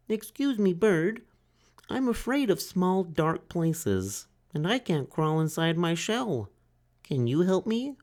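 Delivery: high, timid little turtle voice; small turtle Franklin. A narrator's reading. Franklin asks the duck for help, high and timid. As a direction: ask like a shy child.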